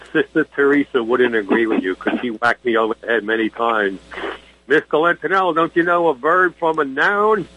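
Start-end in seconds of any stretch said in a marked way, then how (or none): 4.7-7.5 high, stern nun scolding a schoolboy. Retold or imitated. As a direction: play the strict nun teacher. Nun scolding him in story.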